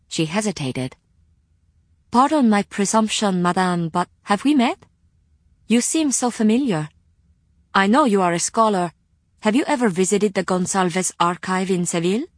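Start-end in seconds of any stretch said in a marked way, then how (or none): 2.1-12.3 lightly voiced courteous librarian; polite librarian voice. Rima asks if they met.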